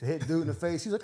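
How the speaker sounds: ordinary talk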